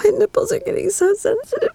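ordinary talk

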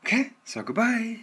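playful high-pitched farewell; chirpy sign-off. Real, not acted. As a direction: sing out the goodbye up high.

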